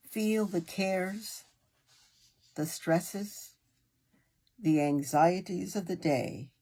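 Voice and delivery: unhurried meditation guide with long pauses; guided meditation. Acted. Meditation leader easing a listener into breathing and relaxation, guided meditation voice. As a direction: guide them gently, phrase by phrase, leaving room to breathe.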